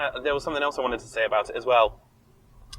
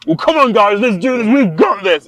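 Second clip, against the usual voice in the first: a touch higher and louder, rallying the squad; pumping up the crew before a doomed fight; mock henchman rally; 6 semitones higher, 8 dB louder than usual. Host mocking the thugs' rally, higher and louder. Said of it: "This film was like".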